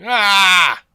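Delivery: loud, high mock exclamation; hammed-up dismay; mock outrage. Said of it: "And I'm like".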